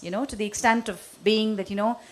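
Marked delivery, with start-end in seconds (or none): none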